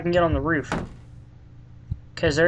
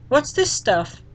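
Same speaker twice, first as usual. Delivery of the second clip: put-on higher kid voice asking; curious little boy; 8 semitones higher, much slower than usual. Fluffy wonders what the stuff is, curious kid voice. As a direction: ask like a nosy small child. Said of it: "a little voice".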